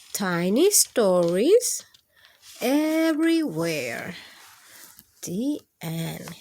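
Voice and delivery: gentle, sing-song picture book reading; lilting read-aloud to kids; reading to children. Acted. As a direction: read it like bedtime story time for little ones.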